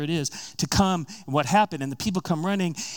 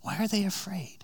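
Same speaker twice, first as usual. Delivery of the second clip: breathy whispered rhetorical question; 6 semitones higher, 5 dB quieter than usual. Real, not acted. Preacher whispering the question to the listeners.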